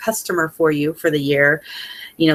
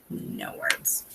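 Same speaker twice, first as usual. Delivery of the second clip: much softer, breath-only, choked up; emotional whisper; 13 dB quieter than usual. Real, not acted. Consultant telling her team, overcome, real emotional whisper. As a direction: barely whisper it, moved past speaking. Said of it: "I'm being all girly right now".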